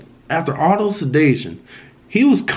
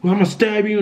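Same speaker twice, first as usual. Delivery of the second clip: higher-pitched mock demonic threat; mild demonic menace, raised but not shouted; retold demonic voice; 6 semitones higher than usual. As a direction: lightly imitate a possessed brother, pitched up. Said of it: "a different demonic type voice".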